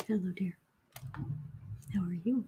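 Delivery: soft pet talk. Real, not acted. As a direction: hush down and coo to the cat. Greeting the cat.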